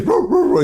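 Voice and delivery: high yappy "roo roo roo" like a small dog; mock dog barking. Streamer imitating the dog he heard, high barking. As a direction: bark it out like the dog in the clip. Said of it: "he hears".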